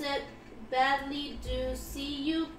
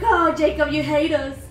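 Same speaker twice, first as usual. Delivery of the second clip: loud, whiny-playful group protest; mock complaint; 8 dB louder than usual. Gym-goer griping at trainer Jacob, mock complaint. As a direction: exclaim it loudly, in mock grievance. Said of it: "We're like".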